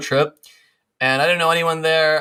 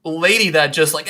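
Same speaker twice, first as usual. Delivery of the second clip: ordinary delivery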